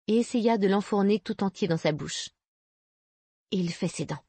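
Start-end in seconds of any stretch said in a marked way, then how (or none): 3.5-4.2 soft, absent-minded aside; absent murmur. A narrator's reading. Mother stroking her teething baby's cheek, murmuring absently. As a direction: murmur it, half elsewhere. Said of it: "murmura-t-elle d'un air absent".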